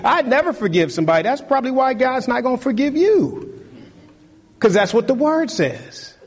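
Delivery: preaching at full force. Real, not acted. Preaching climax on forgiveness.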